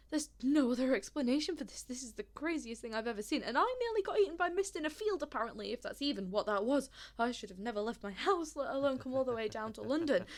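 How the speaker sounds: breathless rant building to loud anger; overwrought outburst. Acted. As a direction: pour it out, frantic and fed up. Overwhelmed, lashes out at stranger.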